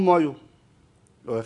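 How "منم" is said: slipping back into Mashhadi "مایو" at the door; Mashhadi accent. Retold or imitated. Suitor failing the accent test at the door, Mashhadi accent. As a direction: answer "مایو" in Mashhadi, short falling, instead of "منم". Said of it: "گفتن که گفت".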